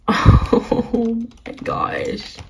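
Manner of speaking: high, slow, airy squeal; overwhelmed delight. Real, not acted. Overwhelmed by her favourite horse.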